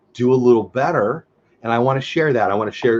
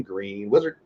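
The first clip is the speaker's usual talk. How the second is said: "Green Wizard" said softly, lower and quieter; quietly savoring; 4 semitones lower, 7 dB quieter, slower than usual. Real, not acted. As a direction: repeat it softly, relishing the name. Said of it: "So I'm gonna say it again".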